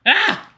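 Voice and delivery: short, high yelp of surprise; startled yelp. Acted. Tripping in the shower doorway, a sharp high yelp. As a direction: yelp as you trip. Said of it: "You tripped on the doorway".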